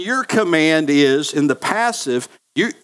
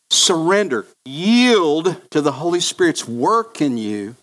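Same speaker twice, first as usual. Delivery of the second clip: ordinary talk, nothing marked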